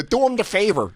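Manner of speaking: high mobster voice; movie tough-guy impression. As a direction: repeat it as a high-voiced gangster. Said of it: "even when he goes".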